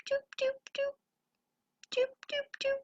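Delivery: very high, soft little doops; tiny rabbit noises. Acted. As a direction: squeak out tiny doops like a bunny. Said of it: "making little rabbit noises".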